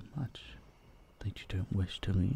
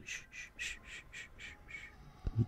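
hushing; 12 dB quieter than usual. Acted. Hushing panicking captive.

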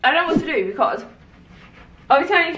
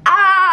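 loud, high cry of pain; pained scream; 8 semitones higher, 7 dB louder than usual. Real, not acted. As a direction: scream out from the chilli burn. Burning mouth from hot sauce.

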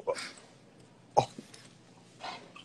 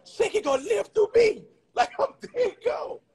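laughing retelling; slower than usual. Retold or imitated. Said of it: "B J just said".

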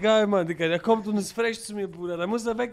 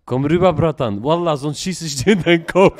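loud, menacing summons; shouted threat; 4 semitones lower, 10 dB louder than usual. Pretend. Threatening a rival to come over, shouted. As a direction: order them over at full volume.

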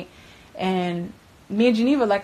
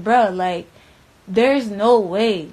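louder and very slow, there's no way spaced out; firm insistence; 8 dB louder, much slower than usual. Retold or imitated. Assuring him she won't go to the police, slow and firm. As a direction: lean on every word of there's no way.

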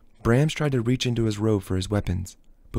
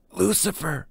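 a high gasped name; 9 semitones higher than usual. Acted. Brahms forced to say the name, gasped high. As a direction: force the name out on a gasp, pitched up. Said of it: "he gasped".

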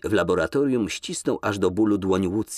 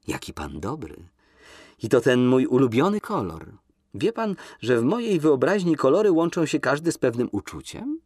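higher, lilting flirtatious lady; coquettish Nina; 5 semitones higher than usual. Acted. Nina teases Kolski in the limousine, high and coquettish. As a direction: lift her voice, coy and teasing.

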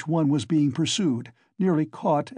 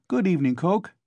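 put-on higher, bright young greeting; a cheerful young man greeting; 5 semitones higher than usual. A narrator's reading. Young newcomer greeting Coke cheerfully. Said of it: "cheerful, ringing voice".

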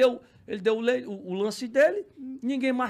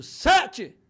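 high, very loud single-word shout; shouted counter-bid; 6 semitones higher, 13 dB louder than usual. Retold or imitated. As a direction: fire the bid straight back. Acts own shouted counter-bid. (contralance gritado)

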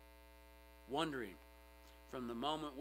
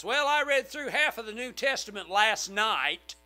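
loud, higher-pitched mock boast; show-off announcement; 5 semitones higher, 10 dB louder than usual. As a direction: announce it proudly for everyone to hear.

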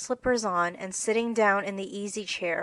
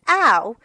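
a loud flat "Ow" like being pricked by a pin; stiff wooden "Ow"; 3 semitones higher, 10 dB louder than usual. Acted. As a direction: yelp as if stuck with a pin, no real fear. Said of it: "suggestive of pins being run into her".

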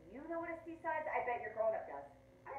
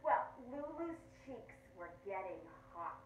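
ordinary delivery, nothing marked

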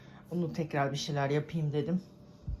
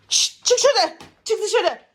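sharp high shout to chase the cats out; shooing shout; 10 dB louder than usual. Real, not acted. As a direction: yell at the cats to get out. (kedileri kovalarken bağırma)

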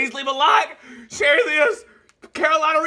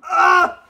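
pained yell; 5 semitones higher, 8 dB louder than usual. Real, not acted. Burning lips.

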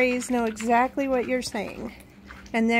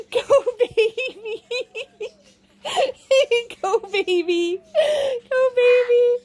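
cheering the dancing bird through laughter; laughing cheer; 10 semitones higher, 4 dB louder than usual. Real, not acted. Parrot dancing, owner laughing.

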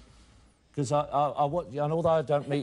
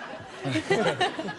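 sudden high cackle of laughter; delighted high laugh; high burst of laughter; 14 semitones higher than usual. Real, not acted. Host laughs at parsnip answer.

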